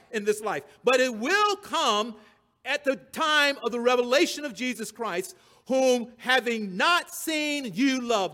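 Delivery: loud, high pulpit delivery; impassioned preaching. Acted. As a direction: hammer each stressed word at the congregation. Preaches at full force.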